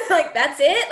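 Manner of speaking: laughing repeat. Real, not acted. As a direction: echo your own disbelief while laughing.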